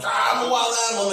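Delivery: high, fast frantic animal voice; crazy possum voice. Retold or imitated. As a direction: play the wild possum going berserk. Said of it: "getting all crazy possum".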